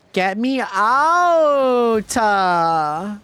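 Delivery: long, high, loud wail; drawn-out gamer rage cry; frustrated yell. Real, not acted. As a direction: drag the words out in an exasperated holler. Frustrated outburst at the game.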